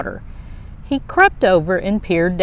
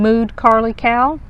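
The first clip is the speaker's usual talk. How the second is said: ordinary delivery, nothing marked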